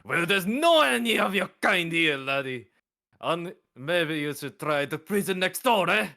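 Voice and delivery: Boston Irish with a sprinkle of Italian; muddled Boston Irish accent. Acted. Guard turning away a foreign visitor, mixed Boston Irish accent. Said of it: "maybe, like, a Boston Irish?".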